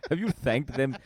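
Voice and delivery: mock uncle, pitched a bit up; reproachful uncle. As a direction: needle him with guilt. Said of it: "That's what he would tell me".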